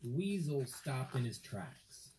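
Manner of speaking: plain reading voice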